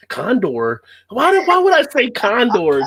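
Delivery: puzzled pitch climb, hammed-up; mock incredulous. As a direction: play the man who misheard, in disbelief. Acting out mishearing man in commercial.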